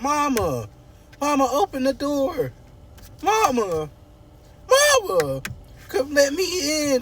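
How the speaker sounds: high, wailing mock plea; hammed-up desperate begging; locked-out daughter calling. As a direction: call "Mama" through the door, pitch climbing. Eva locked out, calling Mama.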